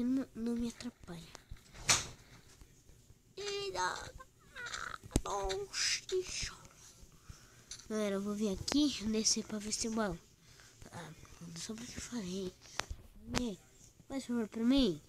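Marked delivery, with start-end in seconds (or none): 3.4-6.0 surprised little whisper. Real, not acted. Surprised gaming reaction. 10.9-12.5 whispered. Real, not acted. Annoyed after jinxing it.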